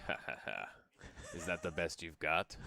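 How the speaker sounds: low, quiet villain chuckle and taunt; mock an anime villain toying with the hero; hammed-down evil laugh, dropped low. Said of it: "the villain starts laughing and he's like".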